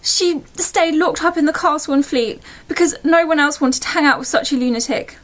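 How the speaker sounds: ordinary delivery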